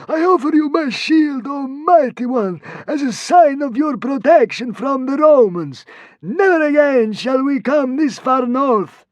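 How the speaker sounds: high, slow, pleading consul voice; tearful consul. A narrator's reading. The sobbing consul offering his shield to the god. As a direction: grovel before the mighty one. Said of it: "he sobbed".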